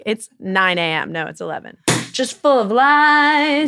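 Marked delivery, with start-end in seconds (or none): 2.1-3.7 mock dramatic. Joking self-mockery.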